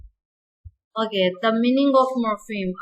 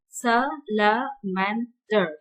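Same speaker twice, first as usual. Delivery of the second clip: slow; much slower than usual. Shown as a demonstration. Teacher spelling out syllables.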